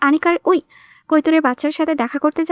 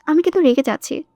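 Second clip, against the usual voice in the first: ordinary delivery, nothing marked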